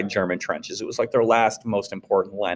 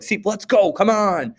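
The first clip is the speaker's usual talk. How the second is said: higher, pushy pep talk; pushy fresh runner; 10 semitones higher than usual. Retold or imitated. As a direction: egg on a tired runner, way up high. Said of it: "you're like".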